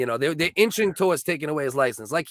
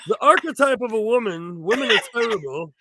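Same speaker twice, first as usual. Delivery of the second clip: mock Jordan Peterson; 4 semitones higher than usual. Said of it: "he's like".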